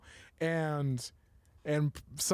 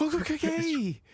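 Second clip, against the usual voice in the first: mock baffled okay; 11 semitones higher than usual.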